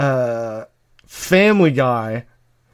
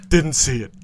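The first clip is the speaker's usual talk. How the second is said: Robert Barone. Acted. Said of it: "Robert Barone".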